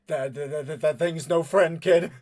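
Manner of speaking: stuttered, rising warning; rattled, halting retort; alarmed stammer. Acted. Frightened man stammers back.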